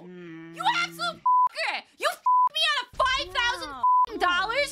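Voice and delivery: real rage at the cheating gone wrong; furious shout. Real, not acted. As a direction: blow up at him for costing you five thousand. Kid's answer lost her money.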